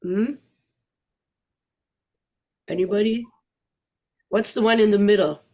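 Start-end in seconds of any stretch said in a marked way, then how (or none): none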